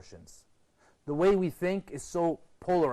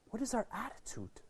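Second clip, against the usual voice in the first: whisper; 4 semitones higher, 10 dB quieter than usual. Real, not acted. Rhetorical question to audience.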